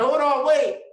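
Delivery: loud, high hold on, wait; panicked stall. Retold or imitated. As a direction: stop them in a sudden panic. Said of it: "I was like".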